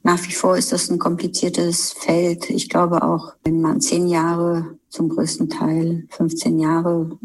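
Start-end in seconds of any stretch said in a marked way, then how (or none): none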